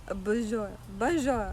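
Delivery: put-on playful French accent on bonjour. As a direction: toss off a mock French greeting. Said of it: "But I had a French accent".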